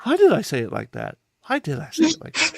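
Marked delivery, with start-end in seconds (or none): 1.5-2.6 much higher, squeaky self-mockery. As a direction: squeal it, teasing yourself. Repeats it, mocking himself.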